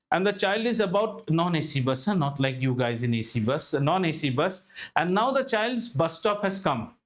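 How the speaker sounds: ordinary delivery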